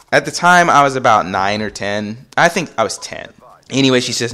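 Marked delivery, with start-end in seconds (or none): none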